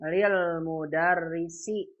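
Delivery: lil mudarrisi drawn out slowly, doubled l; slow clear reading. Shown as a demonstration. (lil mudarrisi dibaca pelan dan jelas)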